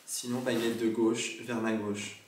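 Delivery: slow, careful questions to a spirit; solemn séance questions. Acted. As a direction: speak slowly to the dead.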